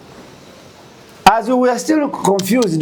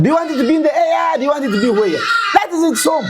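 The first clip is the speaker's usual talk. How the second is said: fast, higher half-shouted rebuke as the father; mock scolding shout; 6 semitones higher, faster than usual. Retelling how the father scolded the children, in a quick half-shout. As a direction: press the point hard like a scolding father.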